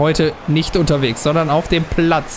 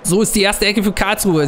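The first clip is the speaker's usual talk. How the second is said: higher, lively play-by-play call; mock commentator; 5 semitones higher than usual. Player commentates his own FIFA match like a broadcaster.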